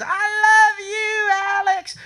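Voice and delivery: high, slow, drawn-out falsetto swoon; mock lady's voice. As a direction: stretch it up high like a lovesick fan. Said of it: "this lady goes".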